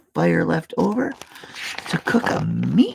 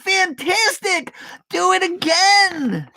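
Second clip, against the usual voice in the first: mock shout; 14 semitones higher, slower than usual. A narrator's reading. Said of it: "Elizabeth shouted".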